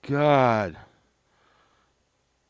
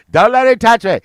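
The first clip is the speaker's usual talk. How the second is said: much higher, much louder frantic rock-star yell; 13 semitones higher, 16 dB louder than usual. Retold or imitated. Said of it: "[name] was like".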